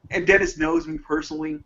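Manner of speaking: normal talking voice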